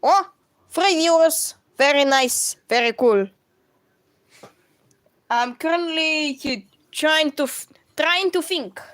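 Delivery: English spoken with Russian sounds; Russian accent. Shown as a demonstration. Streamer greeting viewers and planning a drawing, Russian accent. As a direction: put on a Russian accent, thinking aloud. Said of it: "This is my Russian accent".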